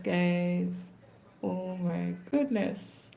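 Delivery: usual speaking voice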